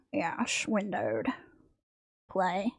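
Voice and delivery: breathy whispered aside. Real, not acted. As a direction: whisper it under your breath. Whispered aside while setting up.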